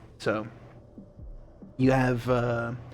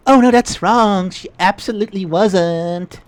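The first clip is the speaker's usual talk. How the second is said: put-on Valley Girl voice; 7 semitones higher, 10 dB louder than usual. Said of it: "I'm having fun giving the Valley Girl, uh, ish, voice".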